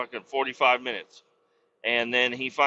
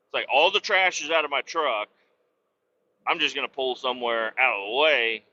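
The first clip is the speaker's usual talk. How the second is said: ordinary talk, nothing marked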